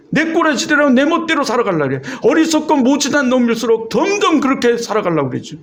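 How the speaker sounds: high-pitched, insistent sermon delivery; preaching rebuke. Real, not acted. Pastor decrying a disobedient flock, preaching rebuke. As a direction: press the rebuke home from the pulpit.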